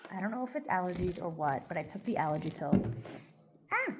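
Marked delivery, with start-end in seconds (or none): none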